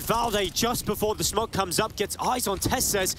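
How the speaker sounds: high-pitched live call, stretched on key words; excited play-by-play. Real, not acted.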